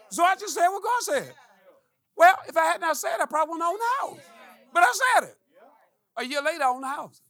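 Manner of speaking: preaching with fire; rising preacher cry. Real, not acted. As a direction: lift it up like a pulpit climax. Preaching at full force.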